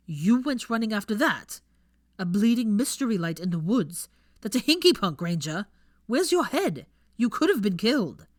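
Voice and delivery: loud, incredulous telling-off; berating. A narrator's reading. Draco loudly berating Hermione for chasing the light. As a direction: tear into her for her recklessness.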